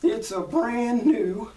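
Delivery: high, loud, drawn-out character voice; slow, high-pitched huckster's pitch; Mister Haney. Acted. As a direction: play the sidekick salesman, stretched out loud and high. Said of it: "I always have to think of his voice".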